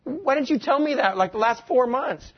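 high-pitched, exasperated complaint; exasperated husband. Retold or imitated. Husband questioning his wife after months of confusion, exasperated. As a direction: replay a husband's baffled protest.

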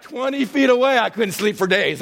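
usual speaking voice

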